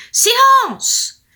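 loud, high furious shout; shouted silence. Acted. Grumpy fairy shouting at the night noise. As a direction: yell it out the window. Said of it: "elle criait".

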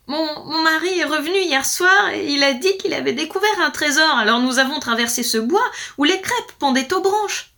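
high, loud, mildly distressed account; tearful wife. A narrator's reading. Wife confesses to lord, mildly tearful. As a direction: stammer at the start, then pour it out. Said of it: "La femme fondit en larmes".

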